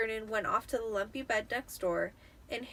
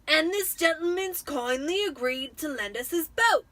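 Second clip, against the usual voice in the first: louder, higher smug uncle; Uncle Vernon, smug; 6 semitones higher, 8 dB louder than usual. A narrator's reading. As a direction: show off the boat to the family. Vernon presents the boat.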